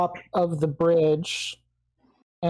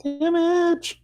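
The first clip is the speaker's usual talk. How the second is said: gleeful shout; 11 semitones higher than usual. Pretend. Player shouts damage roll gleefully.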